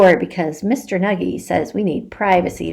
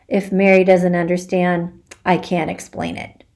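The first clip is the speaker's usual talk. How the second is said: ordinary delivery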